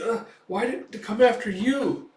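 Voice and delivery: high, worried voice of a startled companion; anxious questioning. Retold or imitated. Puck anxiously questions Guts.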